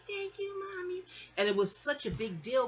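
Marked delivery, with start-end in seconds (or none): 0.1-1.0 put-on much higher, sweet little-girl voice; small child grateful to Mommy; little girl thanking mom. Little girl thanking her mother in a high child voice. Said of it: "I was like".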